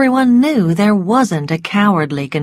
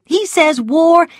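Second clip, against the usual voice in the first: firm but gentle resolve; a hint of brave Melanie; 8 semitones higher than usual. A narrator's reading. Melanie voiced in story.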